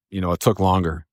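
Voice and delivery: usual speaking voice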